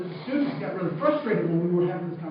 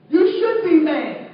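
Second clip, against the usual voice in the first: higher, louder, forceful reply; emphatic retort; 7 semitones higher, 9 dB louder than usual. Retold or imitated. Teacher answering an angry student, forceful and loud. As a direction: hit it hard to wake him up.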